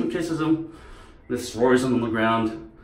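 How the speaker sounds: ordinary talk, nothing marked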